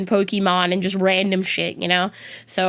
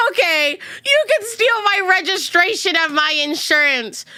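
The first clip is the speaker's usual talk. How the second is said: much higher, hammed-up indignant rant at the thief; mock outrage; 9 semitones higher than usual. Streamer retelling the car break-in to chat, hammed-up outrage. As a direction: play up mock fury over a stolen trash can, much higher. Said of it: "the thing I was crashing out about".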